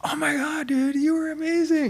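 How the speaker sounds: very high squeal imitating excited boys; gushing fanboys. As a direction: mimic starstruck boys way up high. Imitating boys' fan video.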